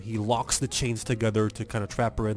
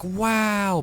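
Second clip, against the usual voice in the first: high, drawn-out mock wow; amazed; 10 semitones higher, 4 dB louder than usual. Donkey and Shrek watching her fight, amazed. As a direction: stretch it out in exaggerated awe.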